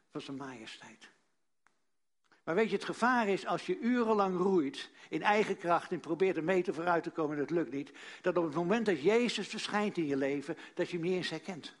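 ordinary delivery

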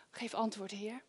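fading to a whisper; hushed plea. Pretend. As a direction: beg God quietly, dying away. Pleading prayer, fading.

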